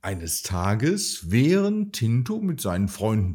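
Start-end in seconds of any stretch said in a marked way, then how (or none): none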